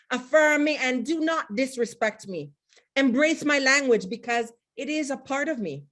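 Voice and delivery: translating her Patois poem into English, recited proudly; English poem translation. Shown as a demonstration. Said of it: "Now to translate".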